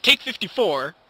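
mock yell of the take number; shouted take count. Real, not acted. Frustrated youtuber yelling his take count. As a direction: call out the take, exasperated. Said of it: "counting his failed takes".

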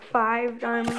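usual speaking voice

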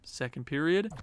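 usual speaking voice

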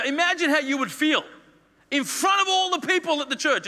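indignant priest. Acted. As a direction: scold her in front of the whole church. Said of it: "Eli goes over and he says".

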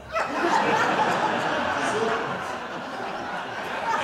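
high cackling laughter at his own pun; laughing helplessly at his own joke; real laugh. Real, not acted.

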